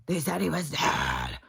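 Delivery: hammed-up angry commenter; mock anger. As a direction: imitate an outraged woman's rant. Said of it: "she was so mad".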